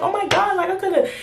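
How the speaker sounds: high-pitched worried self-reproach, acted out; mildly upset, imitating past self; retold sad regret. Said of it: "now I'm sad because I'm like".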